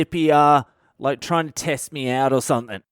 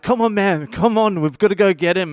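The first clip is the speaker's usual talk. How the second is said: higher urging call, a touch louder; 5 semitones higher, 3 dB louder than usual. Retold or imitated. Brendan urging his mate to go help, higher. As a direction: hurry the friend along. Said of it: "Brendan's like".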